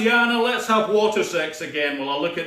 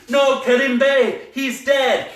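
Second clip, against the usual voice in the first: higher, louder, slow anguish; anguished cry; 8 semitones higher, 7 dB louder, slower than usual. Acted. Bond finding Kerim Bey dead, anguished. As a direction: cry out in grief. Mourning friend's death.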